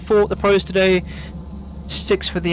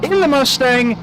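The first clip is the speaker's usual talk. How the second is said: play-by-play commentary; 6 semitones higher than usual. Real, not acted. Live race commentary calling laps.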